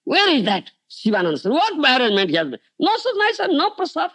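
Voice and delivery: high, angry ranting demand. Retold or imitated. Angry Nityananda demands where Shivananda and his arrangements are, angrily. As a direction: rant indignantly, pitch climbing as you go. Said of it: "Nityananda Prabhu got angry".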